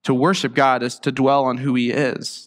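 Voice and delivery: usual speaking voice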